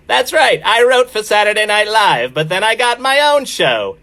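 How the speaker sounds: John Mulaney impression. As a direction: pitch way up into a chirpy comic cadence. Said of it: "I could break out John Mulaney".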